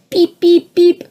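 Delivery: high, loud electronic beeps; imitating a beep. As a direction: chirp it like a phone alert. Phone beeping in pocket.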